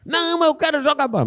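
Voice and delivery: put-on high, fast kid's protest; whiny child. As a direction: whine like a boy who wants to play. Child refuses to study.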